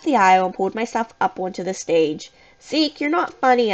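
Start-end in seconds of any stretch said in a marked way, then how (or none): 2.6-3.7 high, scolding call; a mild shout, raised in pitch only; restrained shout. A narrator's reading. Narrator scolding a vanishing friend, shouting mildly. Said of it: "I shouted".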